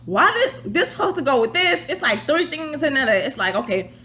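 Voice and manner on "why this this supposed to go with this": high confused questioning; baffled. Retold or imitated. Alone with the mic parts, high baffled questions. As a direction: puzzle over the parts, voice up high. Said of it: "I'm like".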